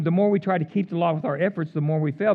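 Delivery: brisk rundown of a familiar line; fast, offhand repeat; quick recap. Acted. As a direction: rattle it off as a reminder. Repeats key line in passing.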